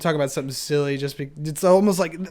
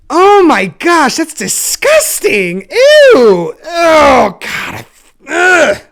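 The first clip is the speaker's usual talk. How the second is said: much higher, louder, slow disgusted squeal; 10 semitones higher, 12 dB louder, much slower than usual. Real, not acted. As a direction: recoil from yourself with a squealing ew. Disgusted at her own preaching.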